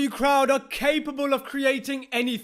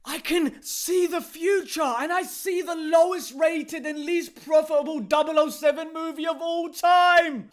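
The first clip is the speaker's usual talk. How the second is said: higher-pitched, building mock prophecy; mock fortune-teller; 4 semitones higher than usual. Pretend psychic seeing the film's failure, mock fortune-teller. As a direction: proclaim it like a seer in a trance.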